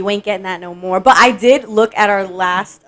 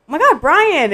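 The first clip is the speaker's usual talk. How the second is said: higher-pitched, excited mock greeting; 9 semitones higher, 4 dB louder than usual. Friend retelling a chance meeting in Gainesville, excited greeting. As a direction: re-enact a thrilled surprise hello. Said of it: "and I was like".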